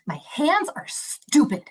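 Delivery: a hint of a frustrated little boy complaining. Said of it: "he looks at me and he goes".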